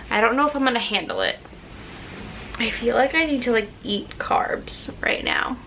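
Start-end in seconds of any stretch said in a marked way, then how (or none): 3.5-5.6 soft confiding whisper; whispered aside. Real, not acted. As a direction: lean in and whisper it.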